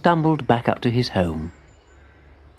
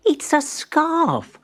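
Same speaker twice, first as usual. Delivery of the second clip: tiny voice drawn out; high, slow spider voice; much slower than usual. A narrator's reading. Abigail tells Timbertwig about the scarf, high and slow. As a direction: say it slowly and small.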